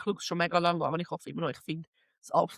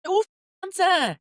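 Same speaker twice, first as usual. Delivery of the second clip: excited gushing; 9 semitones higher, 5 dB louder than usual. Acted. Gushing over plants.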